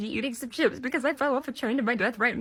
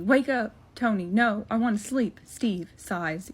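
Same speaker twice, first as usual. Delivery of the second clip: usual speaking voice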